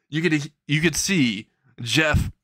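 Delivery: plain reading voice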